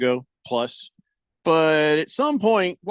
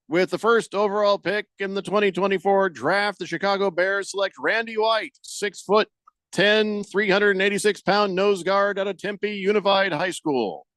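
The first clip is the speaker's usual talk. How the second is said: draft announcer; 5 semitones higher than usual. Acted. Reads a mock draft pick.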